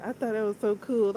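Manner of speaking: ordinary talk, nothing marked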